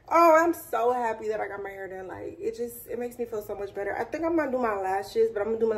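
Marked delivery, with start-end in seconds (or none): none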